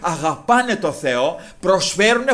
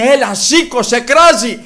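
raised, high calling voice; urgent calling; 5 semitones higher, 8 dB louder than usual. Retold or imitated. Crowd telling the blind man to rise, urgent calling. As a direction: call them to their feet.

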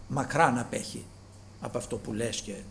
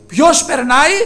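loud question; 9 semitones higher, 13 dB louder than usual. Retold or imitated. Blind man asks who passes.

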